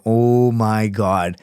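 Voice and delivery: slow, drawn-out stunned voice. Retold or imitated. Looking at friends' photos, slow stunned aside. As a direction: let it sink in slowly.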